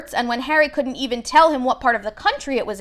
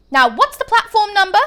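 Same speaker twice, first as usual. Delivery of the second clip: mother's voice; 9 semitones higher, 7 dB louder than usual. A narrator's reading. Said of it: "said the boy's mother".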